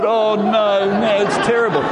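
high, hammed-up lament; mock distress. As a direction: wail lightly over a trivial tragedy. Acts out upset at TV elimination.

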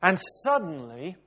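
normal talking voice

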